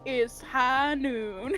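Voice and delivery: cowboy drawl. Pretend. Cowboy joke.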